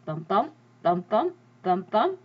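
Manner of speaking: low "bum, bum, bum" like a heartbeat; imitating a heartbeat; heartbeat sounds. Shown as a demonstration. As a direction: thump it out like a heart. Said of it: "the beat of the heart".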